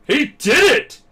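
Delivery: high, loud triumphant exclamation; imitated proud, astonished shout; amazed cheer. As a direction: cry out in acted amazement. Acts mentor's amazed cheer.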